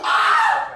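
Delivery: sudden high laughing cry; high laughing shout. Acted. (높게 웃으며 외치는 소리)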